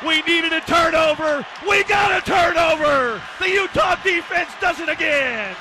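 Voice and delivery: loud, emphatic celebratory shout; jubilant turnover call. Real, not acted. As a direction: proclaim it to the fans.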